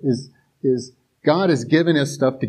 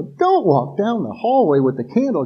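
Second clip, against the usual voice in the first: mock warning; 6 semitones higher than usual. Viewer warning girl on screen.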